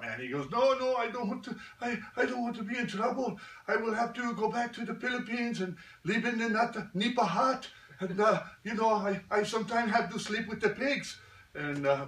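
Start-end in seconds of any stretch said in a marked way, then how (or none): 0.5-11.2 put-on high, frantic accented plea; panicked Pip. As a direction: whine like a scared kid afraid of trouble. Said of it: "Pip just freaked out".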